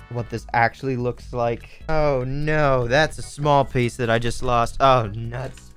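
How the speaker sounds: usual speaking voice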